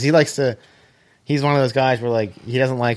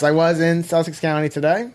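normal talking voice

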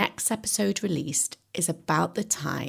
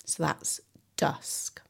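whispered; 2 semitones lower, 6 dB quieter, slower than usual. Shown as a demonstration. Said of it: "teacher repeats key word softly".

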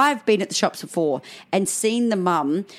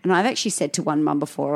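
ordinary talk, nothing marked

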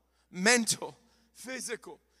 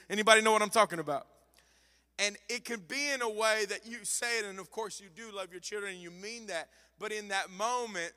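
ordinary delivery

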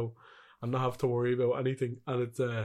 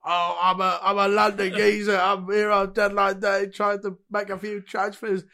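London geezer voice, higher and louder; Harry Redknapp impression; 6 semitones higher, 5 dB louder than usual. Host voicing Harry Redknapp for co-host, cockney geezer impression. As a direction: play an old-school London football manager. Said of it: "Harry, have you got anything to say?".

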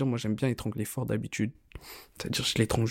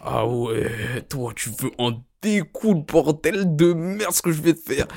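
slow, drawn-out bravado, rising higher; mock threat; 5 semitones higher, 3 dB louder, slower than usual. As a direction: play-act a cocky challenge, dragging out each word. Acted quote, bawdy mock threat to partner.

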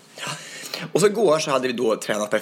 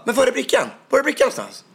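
high, raised-voice demand; demanding guard; 14 semitones higher, 4 dB louder than usual. Retold or imitated. Guard demanding the dancer's badge, high insistent shout. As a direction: press him sharply, pitched up high. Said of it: "och han bara".

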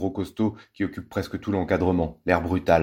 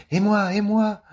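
put-on excited child; 14 semitones higher, 4 dB louder, slower than usual. Eager small child joins in.

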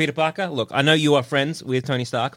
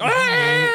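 mock scream. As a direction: screech it out in a high pitch. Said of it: "so you're like".

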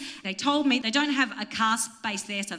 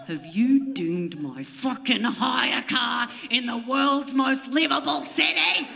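slow, shouted fury; mock outrage; 3 semitones higher, slower than usual. Shouting at the valet about the dented car, mock outrage.

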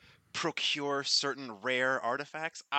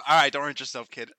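ordinary delivery

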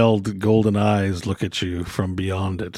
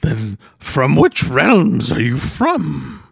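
odd hermit voice; 4 semitones higher, much slower than usual. Acted. Hermit questions the party.

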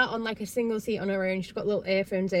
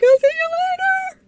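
hammed-up wail, very high and loud; mock tearful goodbye; 11 dB louder than usual. Recapper voices Kaylin crying under the covers, wailed goodbye. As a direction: imitate a bawling girl calling goodbye. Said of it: "under the covers being like".

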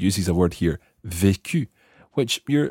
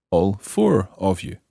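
ordinary delivery

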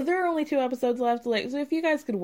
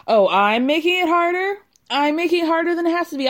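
much higher and louder, fast sarcastic shout; mock furious outburst; 7 semitones higher, 7 dB louder, faster than usual. Girlfriend mocking boyfriend's words, mock-furious fast shout. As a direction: fling his words back at him, hammed-up rage.

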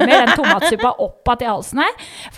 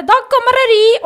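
doctor in a thick Gudbrandsdal dialect; 11 semitones higher, 8 dB louder than usual. Retold or imitated. Doctor instructing the patient, Gudbrandsdal dialect impression. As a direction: put on the Gudbrandsdal dialect, a bit louder.

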